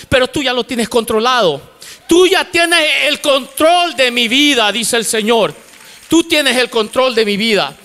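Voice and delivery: usual speaking voice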